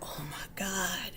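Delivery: imitating a man whispering in awe. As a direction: breathe it out as a whispered gasp. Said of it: "And the guy was like".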